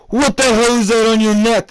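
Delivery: high, loud shocked mom yell. Retold or imitated. Mom spots the hickey, shocked high yell. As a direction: shriek it like a scandalized mom.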